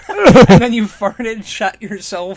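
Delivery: loud laughing roast; real laughing mockery; laughing tease. Real, not acted. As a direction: shout it through laughter. Roasting teasing co-host laughing.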